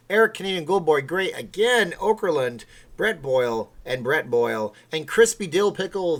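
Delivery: loud, punchy roll call of names; hyped-up credits read; announcer shout-out. Real, not acted. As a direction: boom out each name like an announcer. Reads out supporters' names as announcer.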